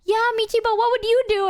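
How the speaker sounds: high-pitched imitation of a friend pressing; high, pointed mock questioning; Mouse impression. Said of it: "And Mouse is like".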